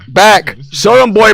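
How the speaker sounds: ordinary talk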